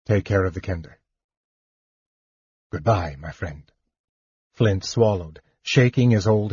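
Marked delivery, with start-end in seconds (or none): 0.0-3.7 soft, low farewell; quiet, subdued parting; softly. A narrator's reading. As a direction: say goodbye gently and low. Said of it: "he said softly".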